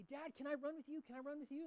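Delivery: put-on begging dad like a keen little kid. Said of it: "and so they always say".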